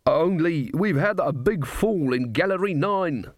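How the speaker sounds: a drowning man asking for help; dwarf king. A narrator's reading. Dwarf king explains mine collapse.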